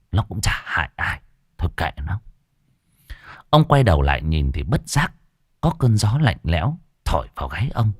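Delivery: ordinary delivery, nothing marked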